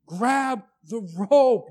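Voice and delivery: loud, urgent call to grab the rope; crying out. Pretend. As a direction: shout the warning across the water. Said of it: "This would be me crying out to him".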